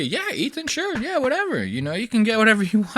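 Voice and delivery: hammed-up high-pitched placating; mock agreeable crew. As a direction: humour the boss in a high, quick, softened voice. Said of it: "they're gonna agree".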